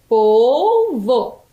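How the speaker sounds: POLVO split, the first syllable held. Shown as a demonstration. Teacher builds the word for pupils, syllable by syllable. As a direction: draw out POL, then place VO. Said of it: "dois animais que têm duas sílabas".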